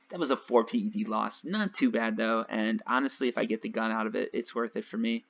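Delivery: usual speaking voice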